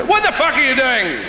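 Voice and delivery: high, loud indignant outburst. Acted. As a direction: burst in, outraged at being mimicked. Interrupts the person mimicking his accent.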